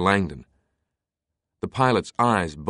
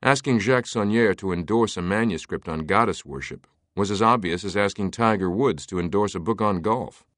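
ordinary delivery, nothing marked

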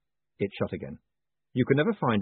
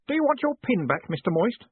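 bright, high eager voice; cheerful young clerk; 12 semitones higher than usual. A narrator's reading. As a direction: chirp it up like a keen boy. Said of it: "he added".

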